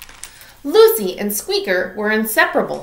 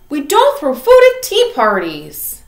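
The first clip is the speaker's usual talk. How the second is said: put-on louder, higher girl scolding her pet; 6 semitones higher, 8 dB louder than usual. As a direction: tell him off at the tea party. Lucy scolds Squeaker.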